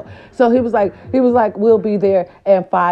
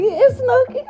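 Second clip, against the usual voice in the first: much higher laughing voice of Snooki; imitating Snooki. Vlogger voicing Snooki laughing about herself. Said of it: "she's like".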